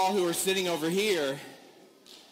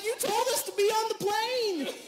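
high, put-on exasperated whine; indignant protest. Dad protesting to the gate manager, mock indignant. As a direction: plead your innocence, squeaky.

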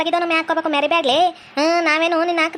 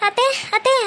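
high little-child call, lightly imitated; child calling auntie; 7 semitones higher, slower than usual. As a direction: mimic a small kid tugging at his aunt. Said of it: "ನಾನು ಹೇಳ್ದಂಗೆ". (ಚಿಕ್ಕ ಮಗುವಿನಂತೆ ಎತ್ತರದ ಧ್ವನಿಯಲ್ಲಿ ಅತ್ತೆಯನ್ನು ಕರೆಯುವುದು)

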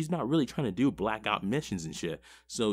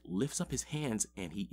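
ordinary talk

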